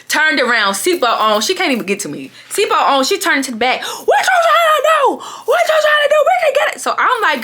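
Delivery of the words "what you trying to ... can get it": hammed-up high-pitched shout; going off. Friend turned round from the passenger seat, going off. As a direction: mimic her frantic challenge, way up high. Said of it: "she really go off like".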